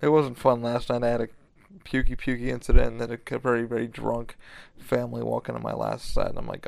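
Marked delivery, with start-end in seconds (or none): none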